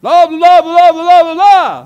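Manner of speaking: high, full-volume gibberish shout; very loud, high mock yelling; mimicked yelling. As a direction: yell nonsense at a foreigner. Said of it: "some people would yell at me".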